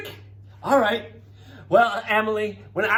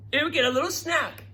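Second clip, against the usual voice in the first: ordinary talk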